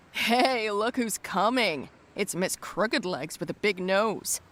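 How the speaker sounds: drawn-out mocking call; jeering yell. A narrator's reading. Bully Teddy calls out to mock the girl, jeering yell. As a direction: voice a bully's taunt, stretched out slowly. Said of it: "yelled that jerk Teddy".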